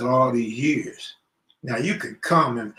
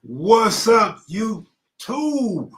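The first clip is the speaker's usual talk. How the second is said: hyped greeting; 5 semitones higher, 8 dB louder, much slower than usual. Real, not acted. Opening greeting to live viewers.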